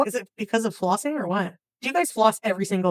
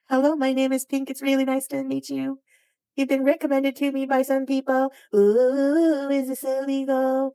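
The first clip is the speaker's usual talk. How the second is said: put-on character voice; 5 semitones higher than usual. Pink saying nice to meet you, high monotone put-on voice. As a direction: hold one high, even note like a toy introducing itself. Plays a character introducing itself.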